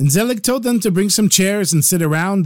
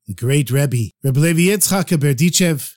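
ordinary delivery

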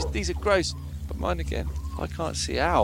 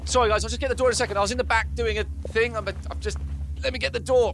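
high, loud flustered panic; panicked flustered; 7 semitones higher, 3 dB louder, faster than usual. Acted. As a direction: scramble to cover up, caught out. Caught by visitors at the door.

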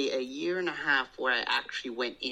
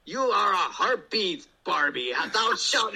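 higher, louder mock-heroic god voice; Thor in character; 6 semitones higher, 4 dB louder than usual. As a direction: ham it up as a lofty Thor talking down to Barbie. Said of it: "I'd always be, like, you know, in character".

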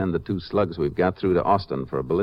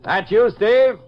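loud call up the slope, higher; 13 semitones higher, 5 dB louder, much slower than usual. Acted. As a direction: shout the question into the dark. Calling to distant ranger.

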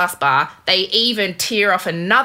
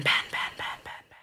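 fading whispered echo; 10 dB quieter than usual. Pretend. Playful echo of a name.

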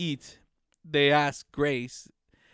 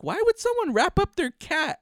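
mock baffled; 11 semitones higher than usual. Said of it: "He's like".

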